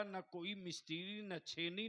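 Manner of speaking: normal talking voice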